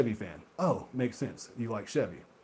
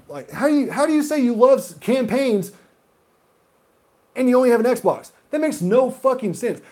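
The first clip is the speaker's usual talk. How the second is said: heated rant; 9 semitones higher, 5 dB louder than usual. Real, not acted. Ranting about Xbox-only players.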